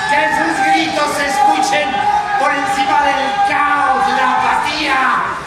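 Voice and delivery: loud, high exhortation; impassioned shouting. Acted. Anchor urging viewers to drown out chaos, impassioned shouting. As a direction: bellow over a roaring crowd.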